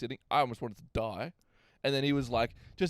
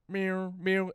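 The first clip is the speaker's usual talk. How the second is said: higher playful cat meow; mock cat meow; 6 semitones higher, 3 dB louder than usual. Babysitter meows to get the kid to eat, playful. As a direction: coax like a silly cat. Feeding trick noise.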